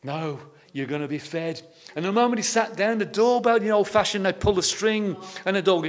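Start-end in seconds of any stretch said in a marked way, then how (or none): 0.0-1.6 low, soft reassuring answer; quiet, calm promise; calm reassurance. Retold or imitated. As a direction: settle the children down gently. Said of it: "And he went".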